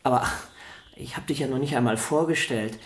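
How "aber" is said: ordinary delivery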